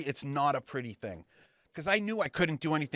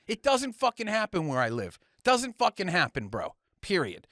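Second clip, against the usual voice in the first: ordinary talk, nothing marked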